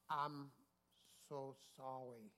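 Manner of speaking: slow, halting, softened apology; put-on slow, impeded speech; feigned speech impairment. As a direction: act as if speaking is a struggle. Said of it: "acted like ... something wrong with him".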